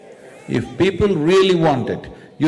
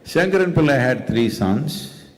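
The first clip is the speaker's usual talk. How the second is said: usual speaking voice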